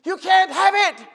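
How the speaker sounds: high, loud and slow, repeating it like a hammer; shouting through the phone. Retold or imitated. Telemarketer refusing the pastor, shouting slow and high. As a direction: yell it back down the phone line. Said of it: "he starts shouting through the phone".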